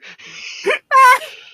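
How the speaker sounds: shrieking burst of laughter; high squealing laugh; loud high laugh. Real, not acted. Laughs at own computer revenge.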